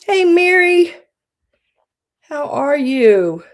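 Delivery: slow, high, beaming hello; bright greeting. Real, not acted. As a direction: sing out hello to a friend. Greeting a viewer.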